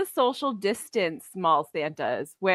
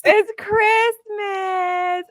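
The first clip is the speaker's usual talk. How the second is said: slow, high, wailing cry; drawn-out wail; 10 semitones higher, 3 dB louder, much slower than usual. Pretend. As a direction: wail it out slowly like a protest. Mock protest about Christmas drinking.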